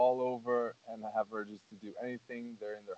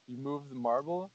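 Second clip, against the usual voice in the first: ordinary delivery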